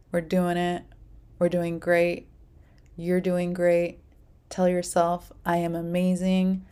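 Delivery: normal talking voice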